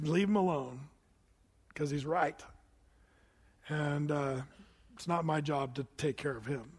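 soft, low and resigned; quiet restraint. Acted. David telling his man to spare Shimei, soft and low.

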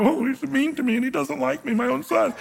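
hammed-up Mary sulking in the corner; whiny hurt mother. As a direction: whimper like a wounded mother. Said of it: "cower in the corner and go".

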